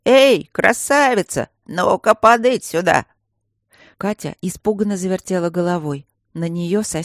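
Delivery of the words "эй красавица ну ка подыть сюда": loud, slow, creaky call; creaky grumpy stone guardian. Acted. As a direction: bark it out like a cranky old statue. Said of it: "окликнули скрипучим и недовольным голосом".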